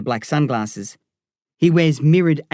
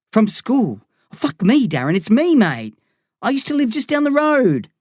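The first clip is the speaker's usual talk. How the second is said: higher, urgent pleading with a friend who doesn't recognise him; frightened pleading; 10 semitones higher than usual. Acted. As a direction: talk fast and scared with a knife at your face. Eli pleads with knife-wielding Darren.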